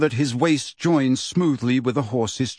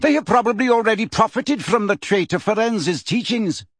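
high-pitched, heated scorn; contemptuous; 9 semitones higher than usual. A narrator's reading. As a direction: spit out the accusation in a raised pitch. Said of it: "interrupted Bane contemptuously".

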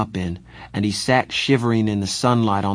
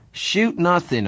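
snapping; 10 semitones higher, slower than usual. A narrator's reading. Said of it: "I snapped".

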